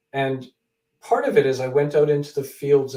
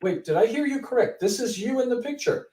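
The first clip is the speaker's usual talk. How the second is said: higher, incredulous wait did I hear you; stunned, checking he heard right; retold double take; 8 semitones higher than usual. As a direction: replay the disbelief of a double take. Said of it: "I had to sort of do a double take".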